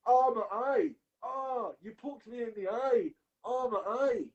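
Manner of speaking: high, whiny mock wail about his eye; fake injury whine. Mocking Colby faking an eye poke, high mock whine. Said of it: "Colby then goes".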